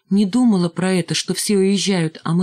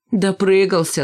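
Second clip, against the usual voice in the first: ordinary delivery, nothing marked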